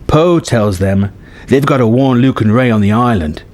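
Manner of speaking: plain reading voice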